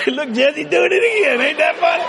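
high, laughing mock voice of the onlookers; amused heavenly onlookers. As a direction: tease him from above with a laugh.

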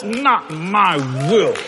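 slow, low, soft surrender; solemn prayer. Retold or imitated. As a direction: pray it, slowly letting go. Quoting Jesus in prayer.

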